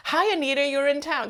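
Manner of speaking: imitating a friendly greeting. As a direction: greet her like a close friend, mock gushing. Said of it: "Because she said".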